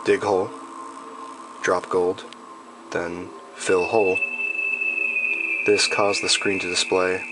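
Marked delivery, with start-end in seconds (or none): none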